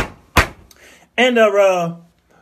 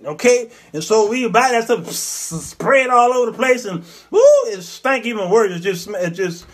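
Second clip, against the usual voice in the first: ordinary talk, nothing marked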